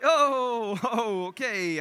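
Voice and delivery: fake-casual brush-off; playing it off. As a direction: act like nothing happened with a nervous chuckle. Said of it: "I tried to play it off".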